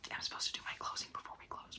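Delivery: ordinary talk, nothing marked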